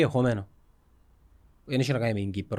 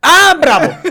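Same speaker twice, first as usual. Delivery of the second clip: high, loud laughing bravo; laughing cheer; 13 semitones higher, 15 dB louder, much slower than usual. Real, not acted. Cheering his friend's joke with a loud high laugh. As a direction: burst out laughing in approval.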